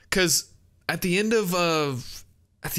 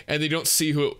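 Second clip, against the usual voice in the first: ordinary talk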